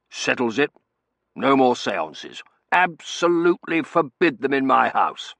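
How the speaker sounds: loud, emphatic decree; blustering head of household; commanding colonel. A narrator's reading. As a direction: lay down the law, leaning on each word. Colonel lays down the law.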